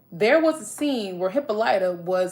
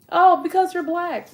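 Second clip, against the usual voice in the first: mock reply; 9 semitones higher, 4 dB louder than usual. Mocking others' answer.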